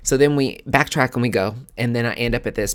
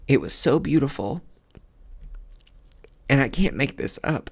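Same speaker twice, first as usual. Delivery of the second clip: higher, emotional voice after a long pause; moved, halting, pitched up; getting emotional; 4 semitones higher than usual. Real, not acted. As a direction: push on through real welling emotion. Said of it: "I'm getting emotional".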